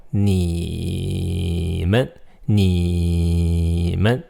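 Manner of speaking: 你 in 你们 held long, low and level; low flat tone. Shown as a demonstration. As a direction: drone 你 evenly before 们. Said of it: "It's just a low flat tone".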